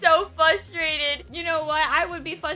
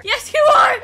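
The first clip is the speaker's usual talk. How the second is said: loud insist; 8 semitones higher, 11 dB louder than usual. Pretend. Insisting in a pretend mom role.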